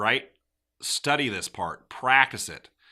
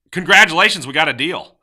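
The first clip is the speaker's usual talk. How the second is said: loud, high, celebratory burst; thrilled, raised-voice congratulations; excited congratulations; 5 semitones higher, 10 dB louder than usual. Shown as a demonstration. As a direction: announce the deal like a big win. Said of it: "So tone".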